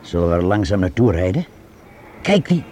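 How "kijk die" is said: higher, urgent pointing out; suddenly alert, warning the others; alarmed. Acted. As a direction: policeman spots the men in the sports car, alarmed. Spotting danger during a stakeout.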